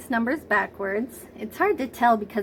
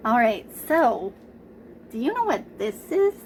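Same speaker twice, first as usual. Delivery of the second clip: normal talking voice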